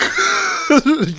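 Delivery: high shouted laugh; loud, high-pitched burst of laughter. Real, not acted. Laughing at own outrage.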